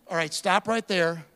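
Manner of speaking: ordinary talk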